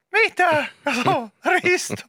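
very high, slow mock mum voice; surprised mum. Mother greeting son home, high mock squeal. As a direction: squeal the wrong names out. Retelling mum's misnaming.

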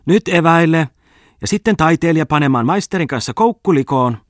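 lady calling the company to eat, loudly. A narrator's reading. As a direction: summon everyone to the meal from afar. Lady calling group to picnic.

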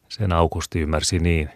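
ordinary delivery, nothing marked

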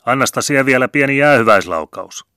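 high, loud call across the water; calling out through cupped hands. A narrator's reading. Narrator hollering to the captain from the shore: high loud call. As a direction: call out to the departing ship. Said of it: "asetin kämmenet torveksi suun eteen ja huusin".

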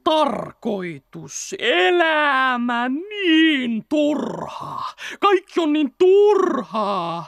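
very slow, crying despair. Acted. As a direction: drag out every word in misery. Fox despairs, drawn out. (hyvin hitaasti, itkuisesti)

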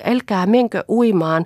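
loud panicky plea, stress on menkö; frightened plea. Acted. Children begging mother not to go swimming. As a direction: beg her to stop, menkö pushed. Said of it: "hätäillen huusivat".

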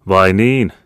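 loud, high "oh really"; boisterous soldier. Acted. Soldier replying to his host, loud and hearty.

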